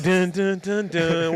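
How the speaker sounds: rhythmic "dun" sound effects; imitated suspense sting. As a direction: voice the suspense beats one by one. Imitating suspense as ball flies.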